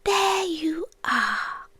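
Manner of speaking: very slow, hushed recognition; fond quiet recognition. Retold or imitated. Creature recalling first seeing the human, slow soft recognition. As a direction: let it out slowly, as if finally found. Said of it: "I thought".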